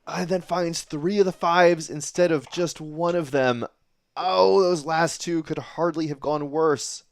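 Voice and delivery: ramp up the play-by-play; excited commentary. Real, not acted. Commentary on poor darts.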